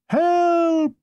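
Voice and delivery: high, sustained cry for help; mock distress call on one long high note. Stranded man calls to approaching motorbike.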